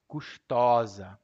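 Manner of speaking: custosa with open ó, kus-TÓ-za. Shown as a demonstration. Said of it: "A pronúncia do masculino é custoso".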